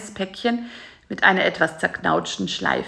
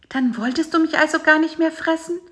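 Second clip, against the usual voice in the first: high fly question; 9 semitones higher, faster than usual. A narrator's reading. Bisi asks.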